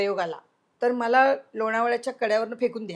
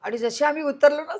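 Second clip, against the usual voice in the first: ordinary talk, nothing marked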